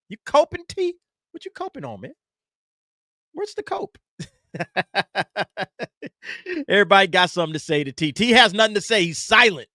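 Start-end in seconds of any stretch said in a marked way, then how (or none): 0.1-4.0 teasing. Real, not acted. Host teasing T after super chat. 4.5-6.6 long chuckle rising in pitch; teasing laugh. Real, not acted. Host laughing after teasing T about coping, amused chuckle.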